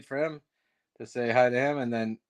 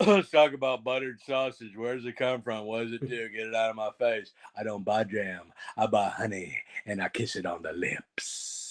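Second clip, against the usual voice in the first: Gary Busey impression; 4 semitones lower, 3 dB quieter than usual. Gary Busey bit after question.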